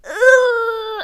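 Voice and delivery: loud high strangled wail; drawn-out high straining screech; imitated strained scream. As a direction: imitate a kid's choked-back scream. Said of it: "it's like whisper screaming. He's like".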